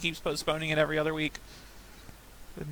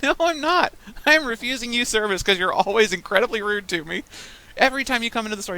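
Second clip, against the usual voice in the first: firm refusal; 5 semitones higher, 5 dB louder than usual. Retold or imitated. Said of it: "I'm like".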